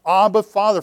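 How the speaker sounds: loud cry to God. Shown as a demonstration. Preacher showing the church how to cry out, loud. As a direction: call out to the Father. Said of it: "is crying out".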